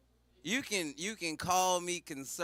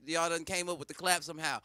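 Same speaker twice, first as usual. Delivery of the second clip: normal talking voice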